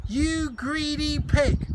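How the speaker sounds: high, loud and slow sneer; angry insult. Acted. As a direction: spit out the insult at the greedy boy. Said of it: "said Matron".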